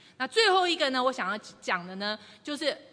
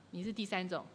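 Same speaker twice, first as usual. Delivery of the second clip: ordinary talk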